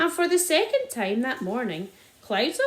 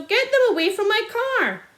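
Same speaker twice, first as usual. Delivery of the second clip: very high, loud desperate shout; 10 semitones higher, 6 dB louder than usual. A narrator's reading. Landowner shouting in despair over his car. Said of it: "shouted Mister Hazel".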